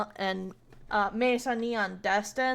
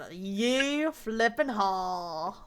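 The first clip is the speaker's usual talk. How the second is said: slow, low mock Southern yeehaw; imitating a kid's slow Southern holler; 3 semitones lower, 6 dB quieter, much slower than usual. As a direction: drag it out in a thick Southern accent, low and lazy. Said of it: "He did one really low with his thickest Southern".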